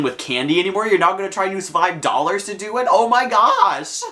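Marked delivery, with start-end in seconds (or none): 2.9-4.0 high, loud, drawn-out disbelief; appalled outburst. Acted. Storyteller reacting to a stranger's shady offer, appalled. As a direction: stretch it out, high and loud, aghast.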